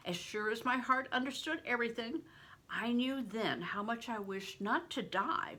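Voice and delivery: usual speaking voice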